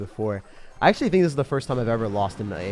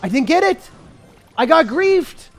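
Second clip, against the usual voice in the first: high, loud outraged wail; drawn-out loser's cry; anguished shouting; 11 semitones higher, 11 dB louder, much slower than usual. Real, not acted. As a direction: yell in disbelief at being robbed. Loses match, outraged.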